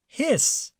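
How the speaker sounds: high hiss; goose hiss. A narrator's reading. Reading to children, a goose's hiss. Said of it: "said the goose".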